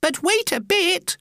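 very high squeaky protest; crying-out little oysters. Acted. Oysters interrupt the walrus, crying out high. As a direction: pipe up in a tiny high voice. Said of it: "the oysters cried".

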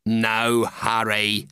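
low, very slow reply; unhurried carpenter. Acted. Carpenter answers the oysters, low and unhurried. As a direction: drawl it low and easy. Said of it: "said the carpenter".

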